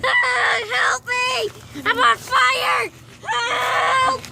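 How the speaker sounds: mock cries for help; hammed-up screaming victim. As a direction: play a panicked victim. Fake victims on fire.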